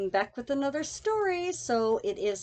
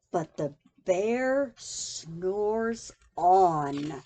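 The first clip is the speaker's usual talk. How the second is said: drawn-out low sleepy line; slow sleepy refrain; 8 semitones lower, much slower than usual. A narrator's reading. Reading to child, slow sleepy refrain. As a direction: lull it like a snore.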